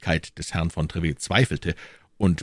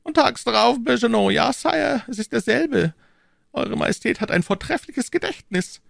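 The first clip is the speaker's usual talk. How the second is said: high, cool and unhurried cardinal; cardinal's calm voice, raised pitch; phlegmatic cardinal. A narrator's reading. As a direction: say it with utter composure. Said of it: "vor Ärger glühte".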